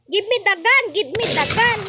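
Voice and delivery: loud pleading shout for the gun; urgent shout. Real, not acted. Gamer yelling at the sheriff to give the gun, loud. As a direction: yell at the sheriff to hand it over.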